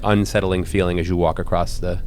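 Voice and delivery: ordinary talk, nothing marked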